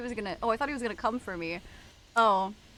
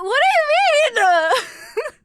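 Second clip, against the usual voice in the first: mimicking chat voice; 13 semitones higher, 11 dB louder, much slower than usual. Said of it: "I understand why people say you and sounds like".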